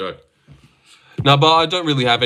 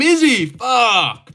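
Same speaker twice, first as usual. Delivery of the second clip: frustrated shout; 9 semitones higher, much slower than usual. Real, not acted. Frustrated at game pick.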